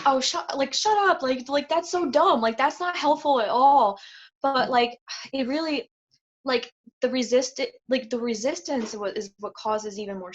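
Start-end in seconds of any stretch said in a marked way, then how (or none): 0.1-4.0 higher, louder mock annoyance, brushing off advice; exasperated dismissal. To people saying sit with it, mock exasperated higher and louder. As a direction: roll your eyes at useless advice out loud. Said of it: "I'm like".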